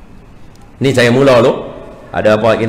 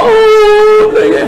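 high, loud startled cry; grandpa's shout; 6 dB louder than usual. Retold or imitated. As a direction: cry out in sudden realisation. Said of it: "Tok Wan pula teriak".

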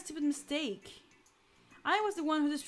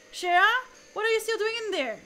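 loud, higher and fast; furious shout; 4 semitones higher, 6 dB louder, faster than usual. Acted. Captain shouting at Shara in the engine, loud and fast. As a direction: yell her name in alarm.

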